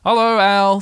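high, loud cheery bear greeting; Pooh. A narrator's reading. Bear arrives at Owl's door, loud cheery greeting. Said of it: "said Pooh".

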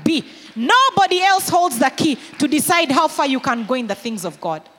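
loud, rising, emphatic preaching; preaching at full force. Acted. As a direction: build each line like a pulpit climax. Preacher building to a climax.

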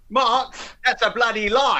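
high-pitched film-star impression; hammed-up accusation in an actor's voice; Michael Caine. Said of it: "like Michael Caine".

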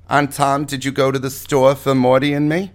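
high, put-on old lady. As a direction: play a fussy old aunt. Aunt Tom character voice sketch.

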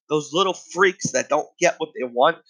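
usual speaking voice

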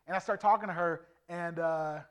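ordinary delivery

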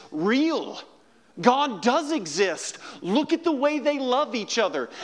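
loud, high-pitched fervent preaching; rising sermon fervour; preaching at full force. Acted. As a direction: preach it out to the whole room.

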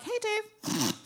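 high, small-voiced hello; nervous greeting. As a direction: greet the boss in a high little voice. Greets boss who brings trouble.

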